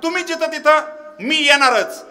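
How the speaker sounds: high, loud kirtan preaching; full-force preaching. Acted. (जोरदार कीर्तनी आवाज)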